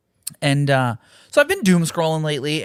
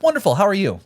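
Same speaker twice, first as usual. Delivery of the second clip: much higher, sing-song customer service cheer; fake cheerful work voice; 6 semitones higher, faster than usual. Greeting customers at work, fake-cheerful falsetto. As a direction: put on the cheery facade. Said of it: "zero follow-up".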